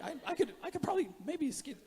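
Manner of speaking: very high, soft, hesitant voice; sheepish bluffer. Pretend. Someone caught out wondering whether to bluff, mock sheepish. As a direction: squeak it out tentatively, mock unsure. Voicing a bluffer weighing options.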